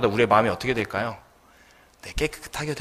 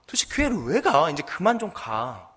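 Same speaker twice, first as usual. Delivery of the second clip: higher-pitched, acted exasperation; exasperated son; 5 semitones higher than usual. Son confronts mother back from worship, acted exasperation. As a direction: play a fed-up son pleading with mother. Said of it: "이 아들이 너무 화가 나가지고".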